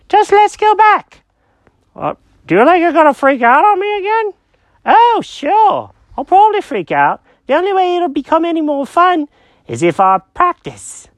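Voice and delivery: high-pitched confused cat; squeaky cartoon cat, lost and puzzled. Jenkins voice.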